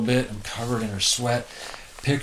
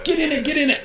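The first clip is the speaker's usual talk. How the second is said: high, rushed self-urging; urgent stoke; 3 dB louder, faster than usual. Retold or imitated. Surfer urging himself onto a bigger wave, high and urgent. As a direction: push yourself onto the wave.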